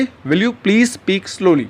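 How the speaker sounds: very slow, each word spaced out for learners; slow teaching model. Shown as a demonstration. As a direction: say it slowly and clearly like a teacher modeling a phrase. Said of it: "English में आप क्या कहेंगे दोस्तों politely".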